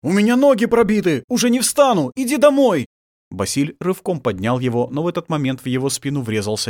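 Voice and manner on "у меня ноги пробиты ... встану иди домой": loud, very high cry, climbing higher; desperate shout. A narrator's reading. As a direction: scream it out as the wounded man. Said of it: "закричал".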